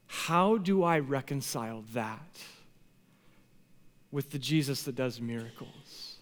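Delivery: quiet, sorrowful bewilderment; hushed, searching lament; pained questioning. Acted. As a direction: ask it softly, weighed down by grief. Voicing his past question in grief.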